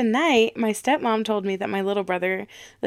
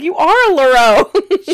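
loud teasing; 7 semitones higher, 13 dB louder, slower than usual. Retold or imitated. Teasing little brother about spoiling.

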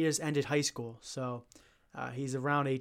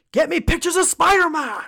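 shouted high and loud like a gruff boss; barking newspaper editor; 14 semitones higher, 12 dB louder than usual. Retold or imitated. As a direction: bellow the order at the staff. Quoting J. Jonah Jameson.

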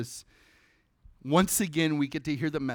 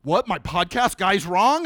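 shocked disbelief; 3 semitones higher, 8 dB louder than usual. Retold or imitated. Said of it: "And he's like".

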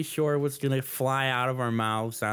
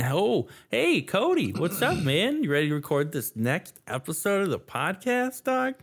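hammed-up Minnesotan accent, pitched higher; Fargo accent; 8 semitones higher than usual. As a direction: greet like a Fargo Midwesterner, in high swoops. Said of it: "that Fargo accent is a lot of fun".

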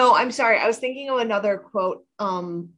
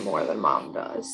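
whispered confession; 4 dB quieter than usual. Retold or imitated. Tearful confession retold softly.

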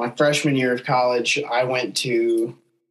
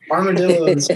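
loud, higher burst; loud excited exclaim; 6 semitones higher, 6 dB louder than usual. Real, not acted. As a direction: blurt it out with glee. Exclaiming about Texas animals.

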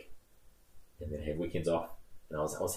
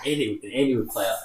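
normal talking voice

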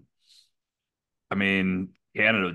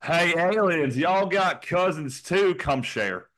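higher-pitched, slow West Virginia drawl; West Virginia accent; 7 semitones higher, slower than usual. Acted. West Virginia inviting aliens to share cousins, hammed-up drawl. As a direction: call out like a West Virginia local. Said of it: "West Virginia's entire state would just be".

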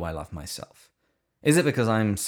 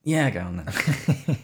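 grudging; 5 semitones higher, 4 dB louder, slower than usual. Real, not acted. Grudging joke answer.

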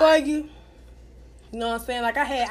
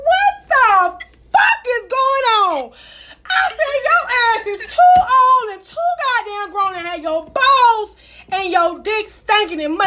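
high, loud, slow crying-laughing yell; outraged shouting; 10 semitones higher, 8 dB louder, slower than usual. Retold or imitated. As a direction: blast him in disgust. Retold outburst, cry, shout.

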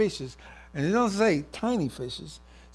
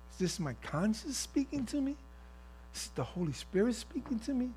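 soft, higher-pitched questioning; hushed, puzzled self-questioning; wondering; 5 semitones higher, 7 dB quieter than usual. Acted. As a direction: ask it softly, pitch lifting in wonder. Wonders who is speaking to him.

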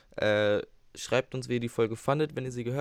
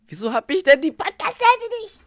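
high, loud whining question; squeaky whine; 12 dB louder than usual. Pretend. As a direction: whine like a cartoon. Squeaky complaint at app.

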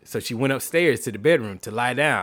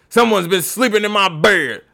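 higher papa bear; 7 semitones higher, 5 dB louder than usual. Acted.

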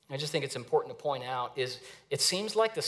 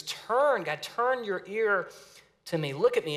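pleading psalmist; 5 semitones higher than usual. Retold or imitated. Psalmist begging God to listen, high pleading voice. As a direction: beg God to turn and listen. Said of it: "And so he says".

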